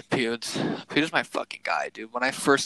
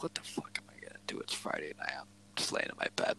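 breathy whisper, much softer; whispered inner thought; 10 semitones lower, 14 dB quieter than usual. Retold or imitated. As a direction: whisper it to yourself, bored and restless. Retelling his bored thoughts in bed.

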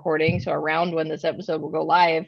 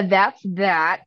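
ordinary talk, nothing marked

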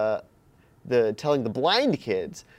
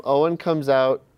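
ordinary talk, nothing marked

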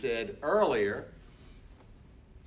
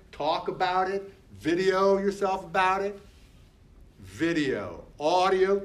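higher, pushing each command; rally her to just do it; urging coach; 5 semitones higher than usual. Acted.

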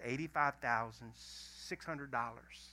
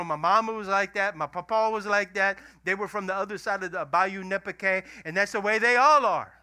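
loud, raised-pitch family excuse; acted insistence; 4 semitones higher, 11 dB louder than usual. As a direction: rattle off the family's excuse loudly, building to the end. Voicing a family excusing its curse.

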